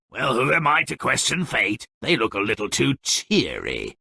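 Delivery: ordinary delivery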